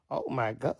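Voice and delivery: ordinary talk, nothing marked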